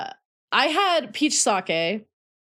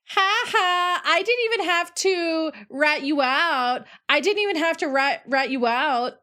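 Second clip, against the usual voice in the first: gloating tease; 7 semitones higher than usual. Retold or imitated. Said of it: "And I was like".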